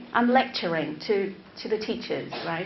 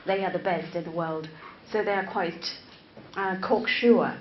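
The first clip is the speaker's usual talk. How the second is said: ordinary talk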